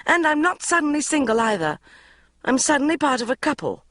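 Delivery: high, loud indignant outburst; exploding. A narrator's reading. Bridget snapping at her mother, high loud outburst. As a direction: burst out, outraged. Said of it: "I exploded".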